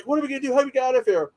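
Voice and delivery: whining what-do-we-do characters; lightly voiced frightened heroes; scared characters. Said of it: "they're like".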